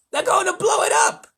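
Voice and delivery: loud, very high scream of alarm; frantic controller yelling; panicked yell. A narrator's reading. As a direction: yell a dire warning. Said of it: "yelled ground control".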